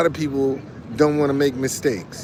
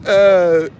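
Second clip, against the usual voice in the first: breathy laugh; 2 semitones higher, 8 dB louder than usual. Real, not acted. Laughs at church story.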